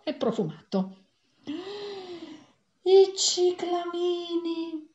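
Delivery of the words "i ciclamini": high, very slow, delighted cry; joyful exclamation. A narrator's reading. Lady spotting cyclamens by the woods, joyful high exclamation. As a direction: sing out the flowers like a happy discovery. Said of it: "esclamò una signora tutta giuliva".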